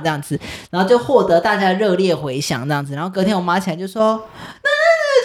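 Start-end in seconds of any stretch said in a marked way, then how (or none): 4.6-5.2 much higher, stammering half-cry; mock wailing mom. Mocking mom waking up, stammering in a fake wail. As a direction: wail like a mom about to scold. Mom wakes and starts scolding.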